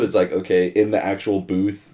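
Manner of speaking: ordinary talk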